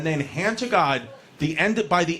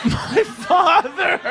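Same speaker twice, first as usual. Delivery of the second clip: drawn-out high emphasis; 10 semitones higher, 3 dB louder, much slower than usual. Real, not acted. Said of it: "drunk drawn-out emphasis".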